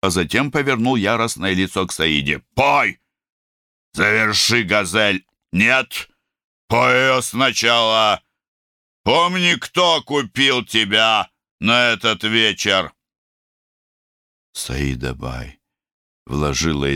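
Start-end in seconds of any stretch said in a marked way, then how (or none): 2.6-12.9 furious shouting. Acted. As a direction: roar orders at a hired singer. Raja orders Saida furiously.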